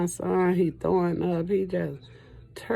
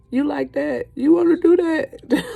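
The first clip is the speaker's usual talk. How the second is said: much higher playful voice of her son, breaking into a laugh; teasing son; 10 semitones higher, 5 dB louder than usual. Retold or imitated. Mother voicing her son teasing her over videos, laughing. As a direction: mimic her son egging her on. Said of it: "be like".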